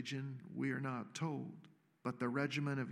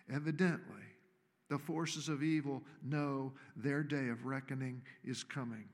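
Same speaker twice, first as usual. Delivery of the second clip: ordinary talk, nothing marked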